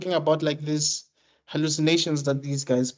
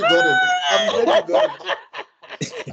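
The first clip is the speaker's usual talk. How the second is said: shrieking with laughter while talking; real helpless laughter; much slower than usual. Real, not acted. Glad a friend got the joke, helpless laughter.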